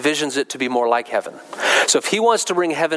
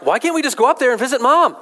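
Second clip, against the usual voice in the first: put-on much higher, eager little-kid voice; four-year-old girl; 10 semitones higher, faster than usual. Small girl asking her aunt to visit Mom, high child voice. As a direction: pitch it right up like a pleading small child. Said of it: "Four years old".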